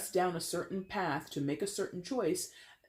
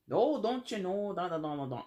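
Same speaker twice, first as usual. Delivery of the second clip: terrible Midwestern accent; 3 semitones higher than usual. Shown as a demonstration. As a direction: do a bad mock Midwestern accent. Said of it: "that's a terrible Midwestern accent".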